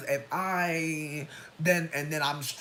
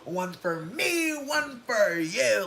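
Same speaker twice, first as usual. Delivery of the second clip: slow, high sing-song counting; slow taunting sing-song; gleeful sing-song; 6 semitones higher, 4 dB louder, much slower than usual. Pretend. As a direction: dole them out slowly and gleefully. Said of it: "I would've been like".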